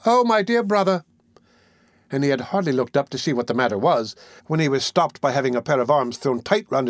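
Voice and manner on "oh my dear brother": high-pitched mock outcry; mild scream. A narrator's reading. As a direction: cry out high, without full force. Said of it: "screaming out very loud".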